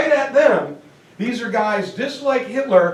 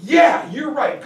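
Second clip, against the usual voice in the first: pumped-up shout; 5 semitones higher, 4 dB louder than usual. Retold or imitated. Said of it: "so charismatic and so pumped up".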